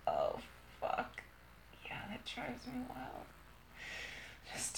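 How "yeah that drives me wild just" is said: soft, intimate whisper; hushed and unvoiced. Real, not acted. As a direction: murmur it on breath alone.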